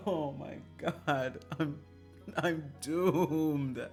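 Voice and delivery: ordinary talk, nothing marked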